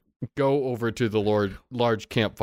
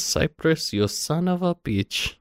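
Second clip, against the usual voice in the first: put-on a girl's high, drawn-out curse; mock-outraged, draw the insult out; Nadia's slow curse; 7 semitones higher, slower than usual. Nadia recognising Cypress, high slow mock-outraged curse. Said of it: "she says".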